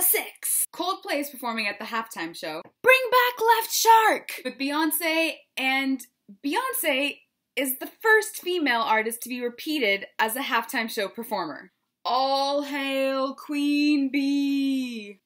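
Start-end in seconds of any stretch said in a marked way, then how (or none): 2.8-4.4 high, loud, slow cry; mock plea. Pleading exclamation. 12.0-15.2 very slow, drawn-out hail; mock proclamation. Reverent proclamation.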